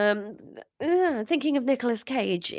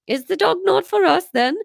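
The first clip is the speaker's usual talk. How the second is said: put-on high, louder pouty Welsh teen; pouting teen; 9 semitones higher, 7 dB louder than usual. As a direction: sulk at the dog news. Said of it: "said Llewellyn, pouting".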